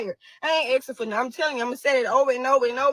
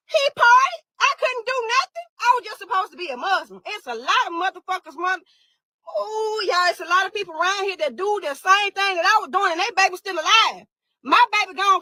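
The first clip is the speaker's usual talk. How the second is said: outraged shout; 5 semitones higher than usual. Real, not acted. Outraged at double standard.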